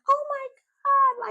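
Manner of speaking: high, loud squeal of joy; squealing excitement. Retold or imitated. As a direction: shriek it like the girls seeing their friend back. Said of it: "the girls are just like".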